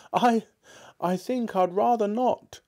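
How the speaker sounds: put-on high, pleading old man's voice; pleading Scrooge. A narrator's reading. Scrooge begs his partner's ghost, high and pleading. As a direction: beg the ghost not to be hard on him.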